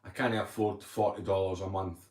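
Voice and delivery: ordinary delivery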